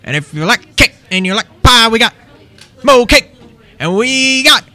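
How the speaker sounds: over-the-top Cajun announcer; hyped-up pastry hawker. Retold or imitated. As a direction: sell the cake and pie like a carnival barker. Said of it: "he loves whenever I do impressions of him".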